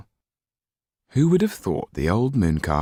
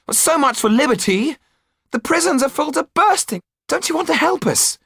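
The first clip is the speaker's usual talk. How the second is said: put-on higher, louder young hero, building passion; Jan's voice; 4 dB louder than usual. A narrator's reading. Jan urging Hulot to help, higher and rising. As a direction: plead and rally them, rising.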